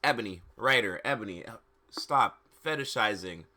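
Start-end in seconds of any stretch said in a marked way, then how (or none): none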